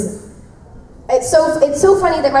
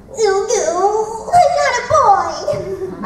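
put-on high, slow cute anime girl voice; character line; 10 semitones higher, slower than usual. Shown as a demonstration. Voice actor showing off her favorite line, in a high cute voice. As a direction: recite the line high and drawn out. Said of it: "I'll be like, "Oh, canon, I love saying Ugu".

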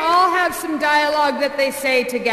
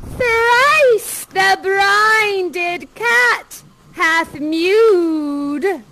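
very slow, raised witch incantation; first witch; 4 semitones higher, 4 dB louder, much slower than usual. Acted. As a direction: intone it loftily as an evil witch, drawing out each word. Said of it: "the first witch says".

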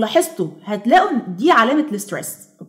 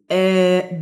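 usual speaking voice

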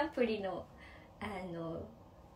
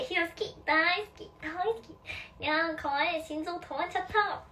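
cutesy fan service; 6 semitones higher than usual. Pretend. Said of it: "って言って".

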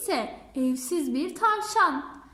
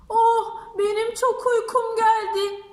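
fake yawn; 5 semitones higher, 5 dB louder than usual. Fox fakes being sleepy.

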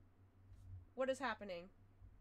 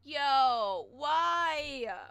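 loud exasperated yo; 8 dB louder than usual. Real, not acted. Stream blocked by maintenance.

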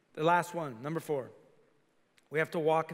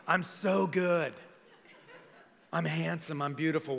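slow, drawn-out smug self-praise; mock arrogance; slower than usual. Pastor mocking churchgoers who think God is lucky to have them, slow and smug. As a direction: preen like you're God's gift. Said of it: "some people have this attitude like".

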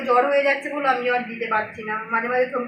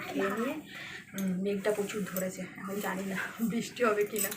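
much softer near-whisper; 3 semitones lower, 13 dB quieter than usual. Real, not acted. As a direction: keep it very soft, the daughter is asleep. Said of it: "খুব আস্তে কথা বলছি".

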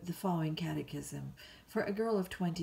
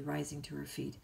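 ordinary delivery

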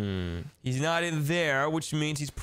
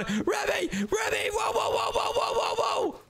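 high panicked shouts of the rat's name, then whoa over and over; screaming. Acted. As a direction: scream in panic as the rats swarm. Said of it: "Why is my brother screaming?".

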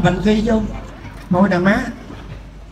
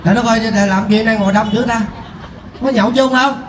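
ordinary delivery

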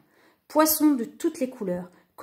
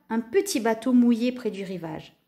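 plain reading voice

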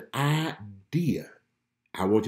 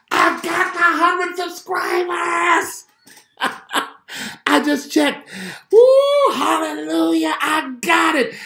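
much higher and louder, very slow shout of joy; ecstatic shouting; 9 dB louder, slower than usual. Real, not acted. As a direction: shout it out, overjoyed and close to tears. Celebrating hitting a hundred subscribers.

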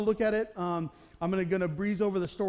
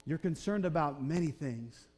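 calm and loving; 5 semitones lower, 5 dB quieter than usual. Retold or imitated. Jesus gently rebuking Martha, calm and loving. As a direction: correct her tenderly, no edge. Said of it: "super calmly looking at her with all this love".

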